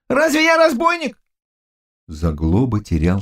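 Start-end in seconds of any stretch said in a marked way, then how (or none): 0.1-1.2 high-pitched, alarmed protest; frightened cry. Acted. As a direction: protest in a scared youth's high voice. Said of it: "кричал перепуганный юноша". (испуганный возглас юноши)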